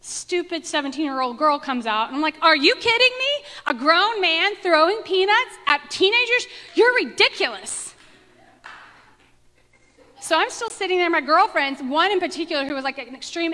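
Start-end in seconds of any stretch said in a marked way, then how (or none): 2.4-7.9 put-on much higher and louder, an indignant teen telling off a grown man; sassy outburst. Teen girl scolds a grown man for throwing peanuts, loud and scornful. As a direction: let the smart aleck loose, loud and scornful. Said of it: "smart aleck, less gracious".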